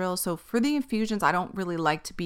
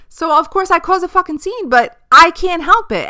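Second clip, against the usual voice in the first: exasperated outburst; 9 semitones higher, 9 dB louder than usual. Real, not acted. Cause a scene.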